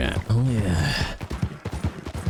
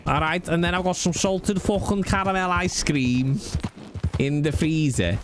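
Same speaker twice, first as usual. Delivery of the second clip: hammed-up Scouse, pitched up in places; Scouse accent; 3 semitones higher than usual. Streamer lists a late-night menu in a put-on Scouse accent. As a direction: play it as a broad Scouser. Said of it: "a Scouse accent".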